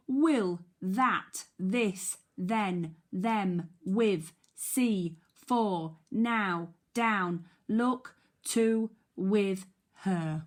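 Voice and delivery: loud, crisp flashcard reading; word drill. Shown as a demonstration. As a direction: call each word out for the class to echo. High frequency word drill.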